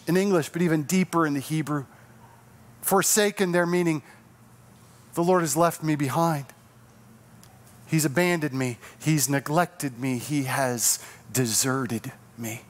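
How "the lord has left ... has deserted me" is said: sorrowful lament. Acted. Paraphrasing the lament.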